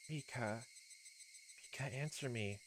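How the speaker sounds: soft, far-off calling; faint distant voice. Acted. Hanyo calling through the crystal, faint and soft. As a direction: sound like a voice fading away.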